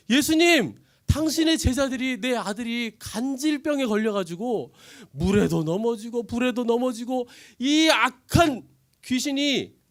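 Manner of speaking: loud, high, slow pleading; desperate plea. Acted. Father begging Jesus to heal his son, loud plea. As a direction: beg with all you have.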